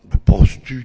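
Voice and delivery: mock outburst of scorn; sudden shout. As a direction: blurt it out loud in disbelief. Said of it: "la petite servante là qui dit".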